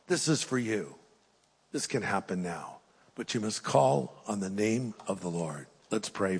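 softly inviting the congregation to pray; quiet invitation. Real, not acted. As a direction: bring it down to a hush for prayer. Drops into invitation to prayer.

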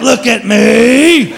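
slow, stretched-out complaint; mock wounded cat; whining cat. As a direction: drag out the self-pity. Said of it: "It's like".

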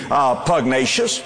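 ordinary delivery

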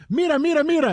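sing-song Mira, mira, mira like a kids' cartoon host; Disney show Spanish. Host retells calling to co-host's mom, kids' cartoon style. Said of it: "like you're watching the Disney show".

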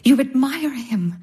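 usual speaking voice

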